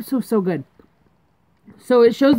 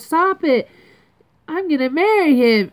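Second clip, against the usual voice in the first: high-pitched little-sister protest; raised pitch, imitating a girl's objection; retold little sister; 7 semitones higher than usual.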